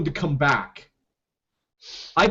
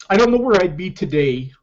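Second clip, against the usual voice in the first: normal talking voice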